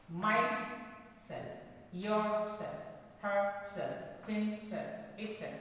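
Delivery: "my-self, your-self, her-self, him-self, it-self" with a gap inside each word; split into two syllables. Shown as a demonstration. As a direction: count out both syllables separately. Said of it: "These five words are disyllabic words".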